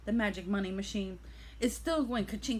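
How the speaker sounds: ordinary talk, nothing marked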